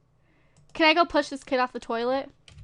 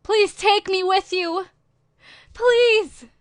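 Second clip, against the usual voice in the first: higher, drawn-out begging; mock pleading; 6 semitones higher, 4 dB louder, slower than usual. Homeless teen avatar begging strangers for adoption, mock pleading. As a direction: play up the plea, rising on the last please.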